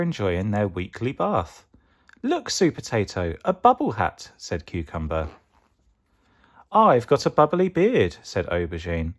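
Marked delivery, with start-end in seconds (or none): 2.2-4.3 high-pitched delighted veggie voice; lightly voiced, high, playful character; excited Cucumber. 6.7-8.2 high, delighted veggie voice; high-pitched playful boast; playful Aubergine. Acted.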